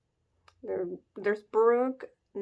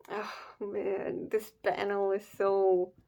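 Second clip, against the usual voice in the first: slow, fading, much softer words of sorrow; saddened; 4 dB quieter, slower than usual. Real, not acted. Reader overcome by the sad panel, slow and hushed. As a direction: trail off, genuinely moved.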